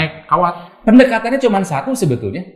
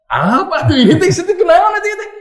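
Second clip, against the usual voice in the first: baffled disbelief; 12 semitones higher than usual. Retold or imitated. Retold baffled reaction to officials.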